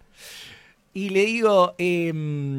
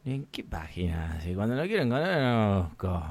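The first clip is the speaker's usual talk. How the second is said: low, soft grumble; defeated mutter; 9 semitones lower, 8 dB quieter than usual. Retold or imitated. Customer reacts to clerk's website excuse, muttering low. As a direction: grumble under your breath, low.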